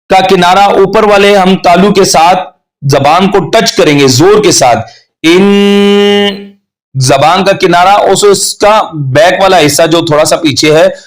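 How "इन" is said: इन held long in the nose; ghunna nasal. Shown as a demonstration. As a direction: hum इन through the nose. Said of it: "गुन्ना की sound निकलेगी". (नाक में दबाकर इन)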